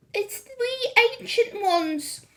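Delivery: put-on slow, high, timid old witch voice; meek voice. A narrator's reading. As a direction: meekly announce yourselves. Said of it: "came a meek voice".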